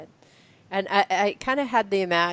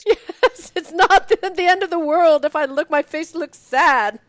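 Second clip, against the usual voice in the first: higher and louder through laughter; laughing mock quote; 8 semitones higher, 6 dB louder than usual. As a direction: giggle out the book's line, higher. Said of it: "And you can say".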